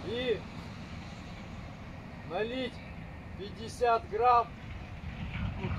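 formal speech. Real, not acted. Formal toast as an order.

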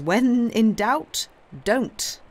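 slow, weighty, every word spaced as a maxim; slow proverb. Acted. Danglars quotes the wise man's rule, slow and weighty. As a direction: hand down the old saying slowly and gravely. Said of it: "as the wise man says".

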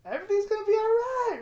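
high, loud, tearful reassurance; crying. Real, not acted. Vlogger telling himself it will be fine, crying. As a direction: push the words out through sobs.

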